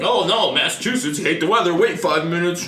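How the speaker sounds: mock local cliché. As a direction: ham up the smug local. Mocking Massachusetts weather cliché.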